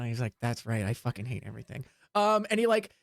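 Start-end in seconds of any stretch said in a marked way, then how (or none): 0.4-1.8 low grumble. Acted. Knife thrower grumbles.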